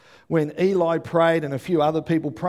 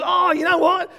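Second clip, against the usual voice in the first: high, loud burst of sudden remembering; excited recollection; 6 dB louder than usual. Retold or imitated. Preacher imitating a friend with news to share, excited. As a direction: blurt it like news you just remembered.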